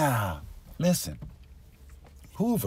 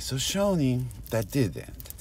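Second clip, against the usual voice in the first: ordinary talk, nothing marked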